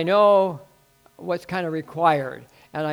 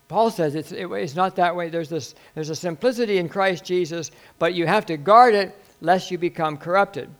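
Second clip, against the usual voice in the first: ordinary talk, nothing marked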